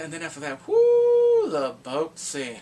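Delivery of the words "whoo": high, loud "whoo"; excited whoop. Real, not acted. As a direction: let out a ringing whoop. Whoop before the boat scene.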